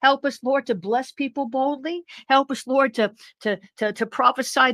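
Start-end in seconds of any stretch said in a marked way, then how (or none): none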